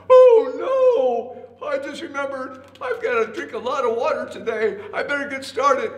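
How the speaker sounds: high, loud flustered elephant; yelp, then babble an excuse to escape; panicked excuse. A narrator's reading. Elephant fleeing the voice in the log, loud panicked excuse. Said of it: "when the elephant heard that".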